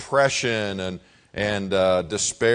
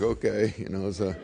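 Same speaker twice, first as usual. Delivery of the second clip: put-on softer, timid boy answer; hushed child reply; meek reply; 3 semitones lower, 6 dB quieter than usual. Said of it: "So I'm like".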